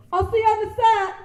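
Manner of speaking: high, loud, showy farewell; breezy call-out. Acted. Broke actor to a friend, high loud bluffing call. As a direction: sing out a bluffing goodbye.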